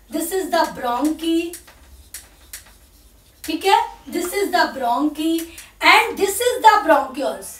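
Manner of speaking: very slow, each word spaced out for note-taking; slow dictation. Shown as a demonstration. Teacher dictating a label to students, very slowly. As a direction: spell it out slowly so they can write. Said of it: "dictating labels to students".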